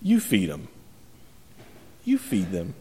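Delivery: a lightly voiced, low, quiet Jesus; calm firm Jesus. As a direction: settle it flatly and firmly, low. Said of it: "And Jesus says".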